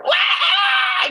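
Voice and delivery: put-on sudden high, loud baby wail; imitated newborn cry. Telling how the baby was suddenly heard, imitated wail. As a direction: mimic a newborn's first squall. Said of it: "all of a sudden we hear".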